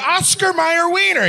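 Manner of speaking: high, incredulous retort; mock questioners' quote. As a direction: raise the pitch for the quoted crowd. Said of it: "And they were like".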